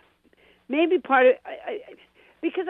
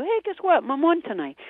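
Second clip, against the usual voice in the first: higher, bubbly announcement; excited news; 8 semitones higher than usual. Retold or imitated. Mother calling home to tell her son, excited. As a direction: burst out with the good news.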